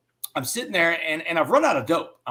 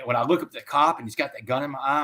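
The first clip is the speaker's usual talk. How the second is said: normal talking voice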